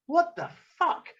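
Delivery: high, slow, incredulous swearing; angry disbelief. Retold or imitated. Looking at the thrown spar, angry disbelief. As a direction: stretch it out high in retold outrage. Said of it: "So I was quite angry".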